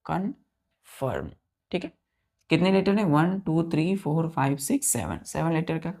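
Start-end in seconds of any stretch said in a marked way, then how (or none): 0.1-1.3 slow, drawn-out confirm, each syllable clear; slow spelled-out demo. Shown as a demonstration. Teacher modelling a new word for children, slow and clear. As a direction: stretch confirm out slowly for the class. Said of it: "सीओएनएफ़आईआरएम".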